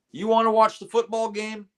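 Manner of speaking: high, challenging sergeant question; drill sergeant voice. Retold or imitated. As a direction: needle him like a drill instructor.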